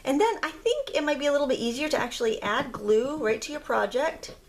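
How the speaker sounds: ordinary talk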